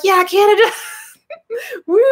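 high excited whoop for Canada; excited cheer. Retold or imitated. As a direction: cheer it like a proud fan. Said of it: "I'm always so excited".

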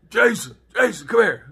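dad calling out. Retold or imitated. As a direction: summon the kid from another room. Dad calling him from another room.

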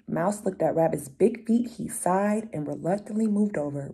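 usual speaking voice

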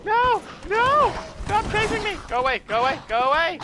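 high panicked screams; screaming in fear. Real, not acted. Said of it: "screaming like a little girl".

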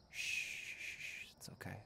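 hushed shushing, barely voiced; soothing whisper. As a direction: whisper her calm like to a crying child. Soothing heartbroken friend.

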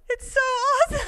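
very high, loud squeal into laughter; overjoyed. Retold or imitated. Speaker reacting to her friend's conversion, squealing with joy.

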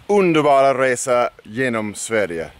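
"underbara resa genom Sverige" said in Swedish, oon-der-bah-rah ray-seh yeh-nom sveh-ree-eh; Swedish title. Shown as a demonstration. Telling viewers about the famous book, title read in Swedish. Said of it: "and his wonderful trip through Sweden".

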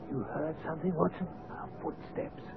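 very soft, secretive murmur; hushed. Acted. Roused at night by Watson's tap, hushed. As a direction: keep it down, almost whispering.

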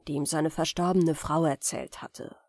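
ordinary delivery

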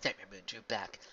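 ordinary delivery

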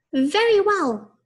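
high, slow squeaky pig; little pig voice. A narrator's reading. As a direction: squeak it out like a piglet. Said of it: "said the little pig".

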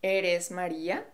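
"¿Eres María?" with the pitch rising at the end; question intonation. Shown as a demonstration. Teacher showing how the rise makes it a question. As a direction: end on an upward lift, asking. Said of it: "raise your intonation at the end of the question".

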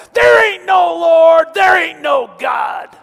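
slow, very loud, high bellow; hammed-up angry neighbor yelling over the fence; dramatic angry shout. Unbelieving neighbor shouting back at the praying lady, hammed-up bellow. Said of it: "that was my dramatic voice for you".

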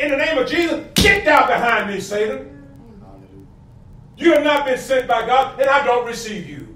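loud, driving sermon delivery; fiery pulpit proclamation; full-force preaching. Acted.

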